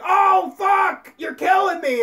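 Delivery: high, loud mock death yell; animal being killed. Animal crying out at its killer, mock anguished shout. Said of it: "the animal is like".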